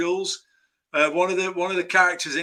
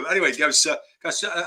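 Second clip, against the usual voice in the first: normal talking voice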